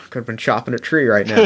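low, muttering goblin grumble; a grumpy goblin muttering under his breath; grumbly goblin. Acted. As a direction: grumble it to yourself like a fed-up woodcutter. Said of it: "Chops gets real grumbly".

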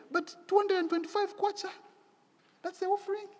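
high, disbelieving squeak at the sum; incredulous. Retold or imitated. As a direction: act shocked that the offering is so small. Retold shock at tiny offering.

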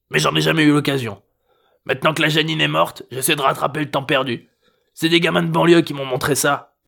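put-on old man sounding like a guilty kid; like a kid justifying himself. A narrator's reading. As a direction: excuse yourself like a caught child. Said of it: "D'une voix de gamin qui se justifie après une bêtise".

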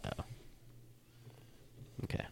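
ordinary delivery

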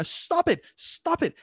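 high-pitched frantic mock yell; mock screaming. Owners scolding a barking dog, high mock scream. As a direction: scream it like a fed-up owner, without full volume. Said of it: "and they're screaming".

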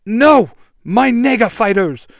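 loud, high wail of protest; dismayed cry. Acted. Korgon stumbling back from his lost fighters, loud dismayed cry. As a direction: cry out in shock at the loss.

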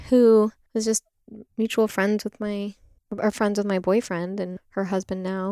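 ordinary delivery

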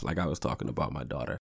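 ordinary delivery

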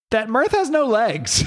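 laughs through the punchline, slowly; a big laugh breaking over the reveal. Real, not acted. Telling a friend about the comic's reveal, laughing.

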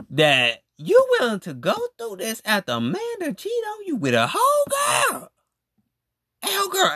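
indignant high shout; outraged. Real, not acted. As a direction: rise to an outraged yell. Outraged rant about cheating.

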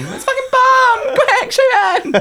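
very high, loud panicked guard yell; mock security panic. Playing panicking airport guards at a suspect, high panicked yell. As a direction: shout the alarm like airport security.

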